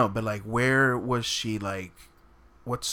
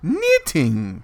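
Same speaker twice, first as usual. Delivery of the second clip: loud, drawn-out mock English accent; 3 semitones higher, 7 dB louder than usual. Shown as a demonstration. Coaching the reader on the accent, exaggerated English accent. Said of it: "You gotta do it in the accent".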